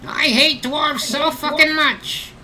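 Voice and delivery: very high, slow goblin screech; ranting goblin. Acted. Goblin swinging at his enemy, high slow hateful screech. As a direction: drag out the hatred in a high creature voice. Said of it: "he's just like".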